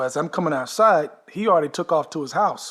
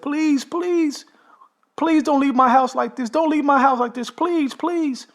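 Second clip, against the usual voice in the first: very high, louder whiny pleading; mock desperate begging; 11 semitones higher, 4 dB louder, slower than usual. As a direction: ham up the husband begging them to stay. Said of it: "And he said".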